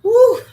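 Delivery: a sudden high, loud whoop; excited whoop. Real, not acted. Vlogger whooping at her own bold lipstick. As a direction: let out a big happy woo.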